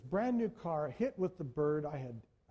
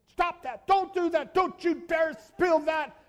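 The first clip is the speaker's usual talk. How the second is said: high, loud parental shout; father to his kids in the back seat, loud scolding shout; yelling at kids; 10 semitones higher, 9 dB louder than usual. Retold or imitated. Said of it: "I was telling my kids".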